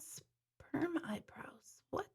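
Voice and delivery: breathy, slow whisper of a viewer's comment; hushed and drawn-out, barely voiced. Real, not acted. Host reading a shocking comment to her viewers, whispered slowly.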